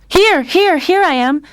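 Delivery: high, loud eager answer; calling back. A narrator's reading. Lionel answering his mother's call, loud reply. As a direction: shout back so she hears.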